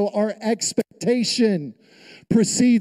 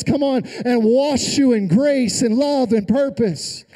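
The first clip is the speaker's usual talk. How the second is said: raised, driving sermon voice; fired-up preaching; 5 semitones higher than usual. Real, not acted. Preacher urging the burdened to come, fired-up. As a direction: preach it with full momentum.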